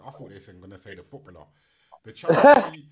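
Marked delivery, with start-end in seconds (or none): 2.0-2.9 booming, mock-grand reading of the name; loud announcement. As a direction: belt out the word like a herald.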